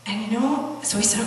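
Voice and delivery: ordinary delivery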